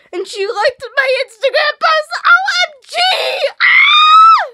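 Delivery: very high, loud squealing scream; ecstatic scream. Real, not acted. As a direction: let out a full fangirl shriek. Fan freaking out.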